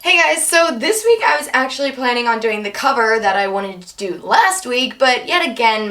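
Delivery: normal talking voice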